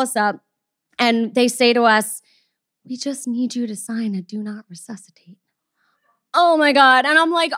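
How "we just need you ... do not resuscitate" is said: a whispered grim request. Retold or imitated. Receptionist asking them to sign the form, whispered. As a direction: breathe it out like bad news.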